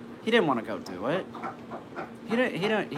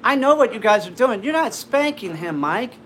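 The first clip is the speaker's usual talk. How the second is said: scolding mom; 11 semitones higher, 7 dB louder than usual. Retold or imitated. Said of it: "my mom comes down and is going".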